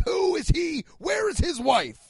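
high-pitched indignant busybody; mock outrage. Comic mimicking people who used to care, mock outrage. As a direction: mimic a scandalized crowd, high.

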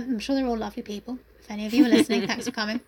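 ordinary talk

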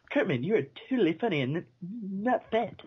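stuttering Jimmy voice. Retold or imitated. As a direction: stutter through it, crutch-kid comedy. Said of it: "he says".

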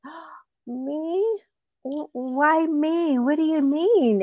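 small, high, startled voice; innocent rabbit. Acted. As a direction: play the cornered rabbit, high and hesitant. Caught rabbit feigns innocence.

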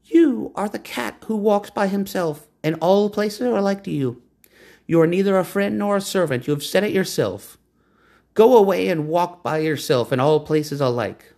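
high, mocking woman's voice; a touch of amused scorn. A narrator's reading. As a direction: laugh the cat off. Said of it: "the woman laughed and said".

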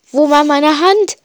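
a touch of panic in the question; panisch. Acted. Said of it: "schrie ich panisch". (leicht panischer Ausruf)

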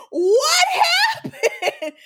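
high shocked cry, half laughing. Retold or imitated. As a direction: blurt it out in disbelief, up high. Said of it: "I was like".

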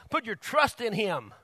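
ordinary talk, nothing marked

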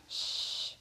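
long "shh" to silence someone; hushing. A narrator's reading. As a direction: hush them with a drawn-out shh. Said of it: "you have to whisper".

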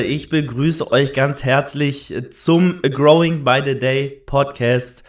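slow, loud show-host welcome; grand announcer intro. Acted. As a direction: announce it like a big show opening. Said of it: "ich muss noch mal neu machen".

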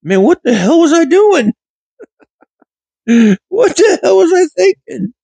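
high, laughing at his younger self; laughing disbelief. Real, not acted. A man recalling his fifth-grade cheek, laughing high. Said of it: "out of body experience laughs".

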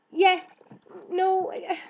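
high, loud false starts; flustered. Acted. Girl answering the hare, flustered. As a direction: fumble for an answer.

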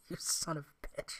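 breathless, whispered through laughter; after laughing hard. Real, not acted. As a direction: gasp it out, still laughing. Right after laughing at mistake.

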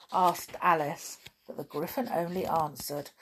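ordinary delivery

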